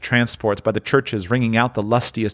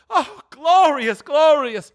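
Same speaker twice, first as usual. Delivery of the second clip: high, loud, drawn-out exultation; rapturous; 5 dB louder, slower than usual. Acted. Scrooge at the open window, rapturous loud cry. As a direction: sing out the joy slowly.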